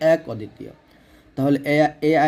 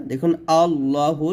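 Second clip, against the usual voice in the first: ordinary talk, nothing marked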